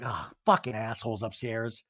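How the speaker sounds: low, soft mock grumble; grumbling aside. Husband grumbling about friends upstairs, muttered aside. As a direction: mutter a complaint under your breath.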